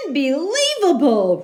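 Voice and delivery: fed up bear protests; rumbling bear. Acted. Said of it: "rumbled Bear".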